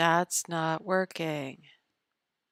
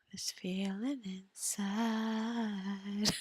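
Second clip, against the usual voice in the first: slow, hushed mock-dramatic line; very slow hammed-up whisper; 3 semitones higher, 4 dB quieter, much slower than usual. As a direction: whisper it like a melodrama.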